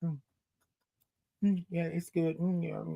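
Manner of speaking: hushed little "mm" of approval; very soft, understated murmur over a sip; mock polite sipping. Shown as a demonstration. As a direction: demonstrate a timid, quiet sip. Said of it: "do this here type of thing".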